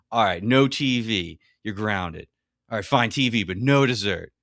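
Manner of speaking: mock-stern dad caving bit by bit; hammed-up parent bargaining himself down; Ted Mosby. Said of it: "he reminds me of Ted Mosby".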